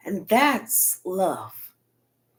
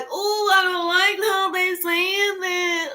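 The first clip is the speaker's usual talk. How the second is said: higher, slow fussy whine; mock a thin-skinned listener; hammed-up offended pearl-clutcher; mocking prissy voice; 8 semitones higher, slower than usual. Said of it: "And they was like".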